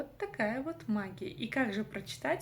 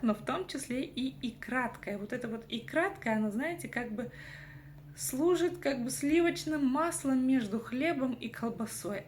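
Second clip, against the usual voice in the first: normal talking voice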